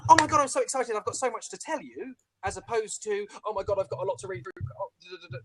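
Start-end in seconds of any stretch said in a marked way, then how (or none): 0.1-2.1 excited. Shown as a demonstration. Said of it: "there's a difference between". 3.4-5.4 fast, dutiful, running through a list; regimented. Shown as a demonstration. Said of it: "go kind of regimented".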